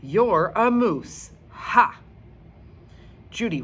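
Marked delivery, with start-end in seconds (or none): 0.0-2.0 louder, slow taunt with a mock laugh; teasing laugh. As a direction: gloat over the joke. Rocky teases back.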